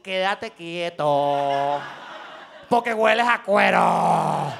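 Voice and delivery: lento y grave, amenaza susurrada de madre; contén la furia y ordena despacio; stern parent warning. Retold or imitated. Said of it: "te querían decir". (madre amenazando en voz baja y lenta)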